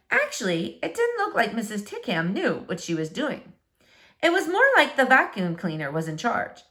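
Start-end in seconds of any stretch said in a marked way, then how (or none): none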